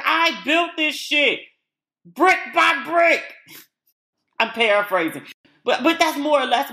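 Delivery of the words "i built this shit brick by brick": high, slow, drawn-out mock Steve. Vlogger imitating Steve boasting to Miranda, high and slow. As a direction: spell it out brick by brick like a proud husband. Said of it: "And he was like".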